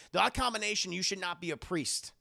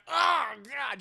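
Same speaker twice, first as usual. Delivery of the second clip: loud, higher, repulsed cry; disgusted shout; 7 semitones higher, 5 dB louder than usual. Retold or imitated. Priest walking into a filthy room, shouting in disgust. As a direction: recoil from the stench out loud. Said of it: "He's like".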